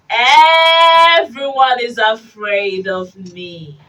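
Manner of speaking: slow, loud, drawn-out boast; swaggering proclamation; boastful mouse. Acted. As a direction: stretch the first word grandly.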